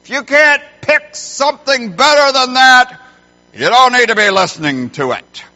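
put-on high, loud bellow at the kids; angry dad shouting orders; yelling. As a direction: holler it across the house, dragging the words out. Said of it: "I yell out at the girls".

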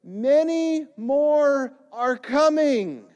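high, loud, slow British call; drawn-out reassurance called in British accent; British diver calling out. Retold or imitated. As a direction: imitate a British rescuer hailing stranded boys. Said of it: "you could hear him say".

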